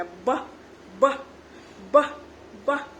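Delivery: short puffed ब, pauses between; airy phonics sound. Shown as a demonstration. Teacher shows how air comes out, short separate ब sounds. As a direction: release each ब as a quick burst of air. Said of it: "हवा जैसा निकलेगा".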